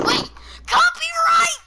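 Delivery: high, slow, loud alarmed cry; alarmed shout. Real, not acted. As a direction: yell it out in alarm.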